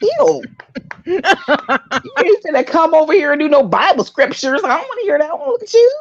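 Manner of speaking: high, playful voice that teases the pastor; a woman swooning over the preacher as a joke; hammed-up swoon at the pastor; mock flirty church woman.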